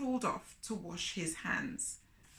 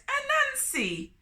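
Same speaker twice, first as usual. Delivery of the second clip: much higher, crying Turtle; 13 semitones higher than usual. A narrator's reading. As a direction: wail at the empty table. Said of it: "he cried".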